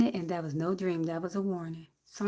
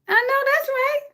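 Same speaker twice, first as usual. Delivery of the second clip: high sing-song agreement; playful high affirmation; high exclaim. Real, not acted. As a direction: cheer them on, pitched up. Agreeing with chat.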